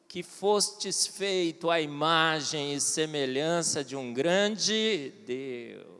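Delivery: low, very slow and grand, like scripture; solemn proclamation. Pretend. Solemn biblical phrase in quote.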